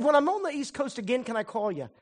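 high and fast with eagerness; excited plea to call again; eager. Retold or imitated. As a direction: rush it out, hopeful and high.

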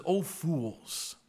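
a touch of low, stern reproach; harsh rebuke. Acted. Jesus rebukes the two disciples, lightly stern. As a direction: scold them, low and firm. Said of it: "That's harsh".

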